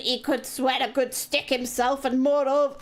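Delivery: put-on half-hearted Scottish accent that gives out; failed Scottish accent. Streamer trying the Scottish accent again, half-hearted. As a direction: try the Scottish voice and lose it. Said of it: "oh, I can't do it".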